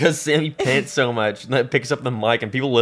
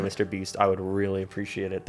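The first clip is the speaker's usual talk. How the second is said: soft begging; 5 semitones lower, 9 dB quieter than usual. Pretend. Begging Mister Beast softly.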